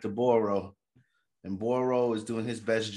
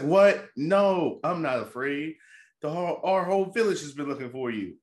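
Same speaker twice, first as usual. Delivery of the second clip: higher, indignant rescuer voice; mock protest; 6 semitones higher, 3 dB louder than usual. Recapper as brother refusing to leave his sister, mock indignant protest. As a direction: push back in pitched-up disbelief.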